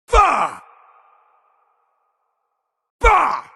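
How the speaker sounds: high, booming shout with falling tail; full shout. Acted. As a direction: blast each word out and let it drop. Isolated battle shouts in game.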